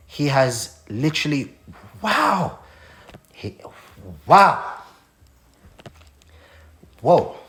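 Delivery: fighting back tears mid-sentence; choked up. Real, not acted. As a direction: voice catching, words breaking off. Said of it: "Didn't expect to do none of this".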